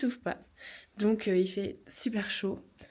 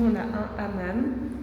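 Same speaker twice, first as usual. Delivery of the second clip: cathedral echo; 5 dB louder, slower than usual. Real, not acted. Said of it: "Oula, ça résonne ici".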